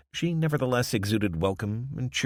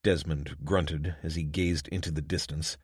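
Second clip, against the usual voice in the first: ordinary delivery, nothing marked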